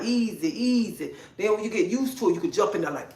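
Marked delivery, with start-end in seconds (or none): 0.0-1.1 soft, slow, repeated easy; gentle easy. Shown as a demonstration. Coaching ladies on a little speed at a time, soft and slow. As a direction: coax them along slowly and quietly. Shows how to start slowly.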